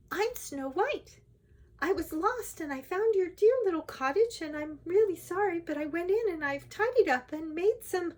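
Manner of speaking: high-pitched storybook princess explaining herself; high, eager and apologetic. A narrator's reading.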